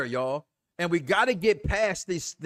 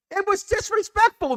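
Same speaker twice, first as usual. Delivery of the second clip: normal talking voice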